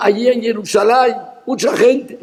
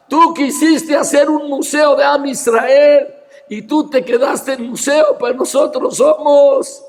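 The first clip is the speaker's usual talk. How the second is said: raised, ringing sermon voice with shouted peaks; fiery preaching; 3 semitones higher than usual. Acted. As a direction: preach it to the crowd, full force. Preaching at full force. (predicación encendida)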